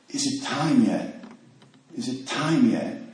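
quiet, slow, repeating it in a daze; slow dazed mumble. Retold or imitated. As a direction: murmur it like a sick man asking over and over. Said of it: "he's saying something over and over again".

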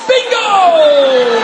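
high, loud triumphant holler; yelled exclamation. Retold or imitated. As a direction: imitate a sponsor yelling at a breakthrough. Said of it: "Actually, he yelled".

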